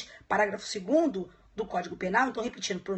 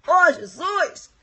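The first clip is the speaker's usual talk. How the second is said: mock dismay; 6 semitones higher, 9 dB louder, much slower than usual. Real, not acted. Exclaims over her own accent.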